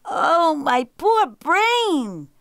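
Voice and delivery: high, loud, drawn-out jackal wail; crying out, bewildered. A narrator's reading. Jackal cannot follow the story, wailing. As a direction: wail slowly in mock befuddlement. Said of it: "cried the jackal".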